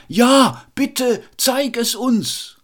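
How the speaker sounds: high, loud, slow eager cry; excited crowd cry. A narrator's reading. The thrilled townsfolk call up to Till, excited. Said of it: "dass sie riefen".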